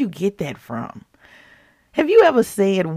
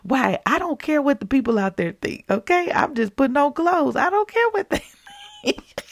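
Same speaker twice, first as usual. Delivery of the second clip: defiant laughing rant; 9 semitones higher than usual. Retold or imitated. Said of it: "I'm like".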